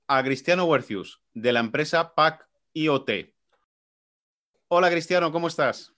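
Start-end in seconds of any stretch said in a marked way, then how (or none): none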